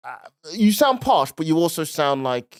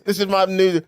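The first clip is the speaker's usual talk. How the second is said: normal talking voice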